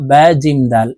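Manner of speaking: usual speaking voice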